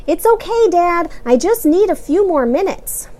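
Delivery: high, loud nervous calling. A narrator's reading. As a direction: call out nervously. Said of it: "James called nervously".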